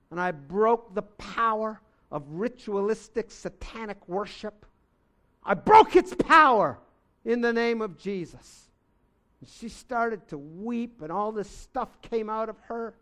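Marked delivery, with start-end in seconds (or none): 5.4-8.7 loud, forceful proclamation; commanding shout. Retold or imitated. Preacher retells rebuking the spirit, loud commanding shout. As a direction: bellow it out like breaking a chain.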